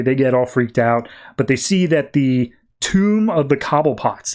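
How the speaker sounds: ordinary delivery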